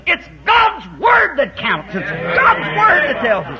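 slow, loud, high preaching; preaching shout. Real, not acted. Preacher to congregation, slow loud shout. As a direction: hammer the point home from the pulpit.

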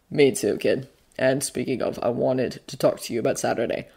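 low, soft character voice; Bucky, easygoing guardian. Acted.

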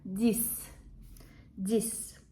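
DIX ending in a hissed S sound. Shown as a demonstration. Teacher explaining the final X, DIX with an S. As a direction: say DIX as "deess". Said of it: "читається S".

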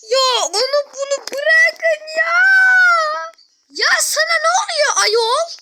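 laughing, crying, shouting; ecstatic shout. Real, not acted. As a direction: burst out in overjoyed shouts. Shouting joyful news back.

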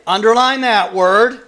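ordinary talk, nothing marked

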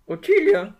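loud, high shout of the name; searching for Ottilie, calling loudly. Acted. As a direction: call across the beach. Calling for lost friend.

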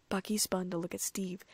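plain reading voice

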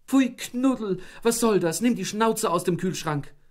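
high, loud scolding; scolding the dog. A narrator's reading. Wife scolding the dog at the fridge, angry. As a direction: tell the dog off sharply.

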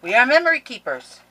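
high, loud proud announcement; excited read-out. Real, not acted. Crafter reads paper brand name, thrilled and loud. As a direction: proclaim the name with glee.

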